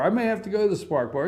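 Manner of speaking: normal talking voice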